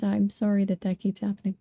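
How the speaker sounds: usual speaking voice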